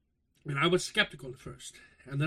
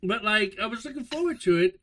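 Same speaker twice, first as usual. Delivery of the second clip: ordinary delivery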